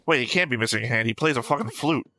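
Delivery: usual speaking voice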